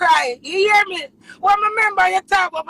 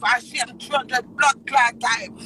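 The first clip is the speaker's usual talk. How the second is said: ordinary talk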